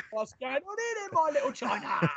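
high, hammed-up Cockney geezer voice; mock Cockney. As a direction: ham up a bad Cockney accent, pitch climbing. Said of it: "your Cockney accent is as far from Tim Henman".

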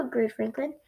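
ordinary delivery

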